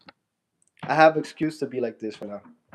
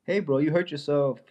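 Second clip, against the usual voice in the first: ordinary talk, nothing marked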